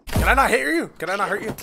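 high, loud frustrated questions; exasperated outburst. Real, not acted. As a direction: demand it, fed up with missing. Frustrated at dodging enemy.

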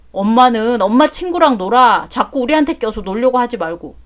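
ordinary talk

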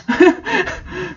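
high, soft disbelieving laugh; incredulous laugh. Real, not acted. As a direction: laugh at the nonsense. Reader laughing at absurd text.

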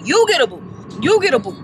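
high-pitched, hammed-up handing out to each person; mock giveaway announcement. Parking staff dishing out boots to everybody, mock giveaway announcement. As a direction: punch each YOU high, like awarding prizes.

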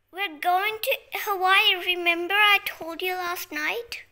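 play-acted loud explaining; insistent loud reminder. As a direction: remind a sleepy sister at full volume. Acted reminder to sleepy sister.

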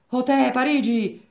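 loud, high hail across the road; calling out. Acted. Renzoni calling to his friend Parigi from a distance, loud. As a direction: call out to catch his attention. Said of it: "lo chiamò".

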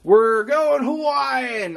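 high, drawn-out happy shout; excited cheer. Acted. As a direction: cheer it out slowly. Excited outfit decision, cheering.

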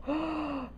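soft, high gasp; amazed gasp. Real, not acted. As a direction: breathe in sharply with wonder. Astonished at butterflies.

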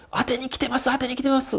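high, frantic repeated plea; 電話口でパニックになった高い声; panicked. Retold or imitated. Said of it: "ってテンパってるらしくて".

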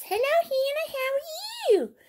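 very high little bear voice greeting Hannah; teddy bear voice. Acted. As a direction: play the teddy saying hello. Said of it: "Bear goes".

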